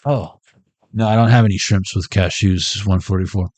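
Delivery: ordinary talk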